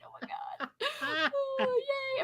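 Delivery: breathless laughing whisper; helpless laughter. Real, not acted. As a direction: laugh until it squeaks. Overcome with laughter.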